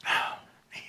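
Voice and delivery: usual speaking voice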